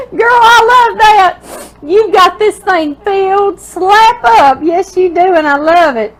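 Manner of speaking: high, loud, gushing praise; real delight. Real, not acted. Reader to the writer about a crammed card, delighted. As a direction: squeal it out, thrilled.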